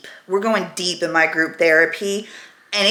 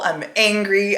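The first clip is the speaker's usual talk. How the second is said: normal talking voice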